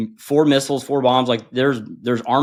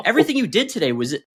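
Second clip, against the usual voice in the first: much higher, teasing incredulity; mock disbelief; 8 semitones higher than usual. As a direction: rib him for believing it, way up high. Mocking student's belief he passed.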